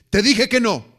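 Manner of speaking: loud, high yell of a furious father. Retold or imitated. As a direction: shout the no at the son. Father yells at son. (el padre le grita furioso al hijo)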